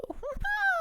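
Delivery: tiny squeaky laugh; falsetto giggle. Shown as a demonstration. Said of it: "two different characters".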